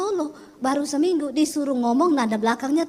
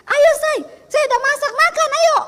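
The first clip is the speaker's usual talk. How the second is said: high, loud, eager call; loud excited Sulawesi-style call to breakfast; excited shout; 9 semitones higher, 7 dB louder than usual. Retold or imitated. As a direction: call him cheerfully to eat at full voice. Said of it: "ketika saya menikah dengan suami".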